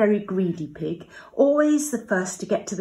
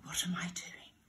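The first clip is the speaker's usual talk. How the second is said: softly to himself, much quieter; hushed thought; 12 dB quieter than usual. Acted. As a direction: think it in a hushed worried voice.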